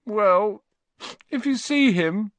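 high, plaintive, forlorn; boy giving up, close to tears; miserable. Acted. Boy leaving after asking about his toad, miserable. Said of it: "said the boy miserably".

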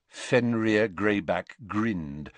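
ordinary delivery, nothing marked

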